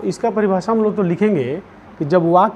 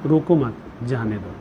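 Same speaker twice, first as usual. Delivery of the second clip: "रोको मत" together, then "जाने दो" dropped lower; pause after मत; 4 semitones lower, 3 dB quieter than usual. Shown as a demonstration. Teacher dictating the changed sentence, pause after "मत". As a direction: break after "मत", let "जाने दो" fall softer. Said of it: "comma का प्रयोग बदल दीजिए".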